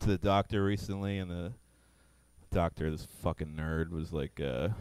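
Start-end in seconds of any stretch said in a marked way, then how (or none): none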